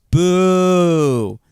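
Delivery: mock heckle. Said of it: "one guy in the crowd goes".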